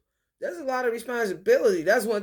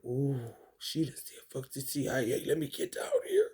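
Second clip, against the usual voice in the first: hushed quoted reaction; 7 semitones lower, 8 dB quieter than usual. Pretend. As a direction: quote a startled, quiet aside. Voicing Allah's hushed reaction.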